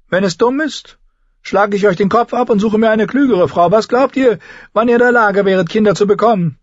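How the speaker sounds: high, loud cruel king; cruel boy-king. A narrator's reading. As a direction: threaten her with a child's glee. Joffrey threatens Sansa. (hoher, lauter grausamer Knabenkönig)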